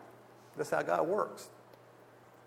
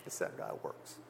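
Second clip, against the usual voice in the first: breathy whispered aside, almost unvoiced; a reverent whisper to the congregation; hushed aside; 11 dB quieter than usual. Real, not acted. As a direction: let it slip out under your breath in awe. Awed aside after the phone story.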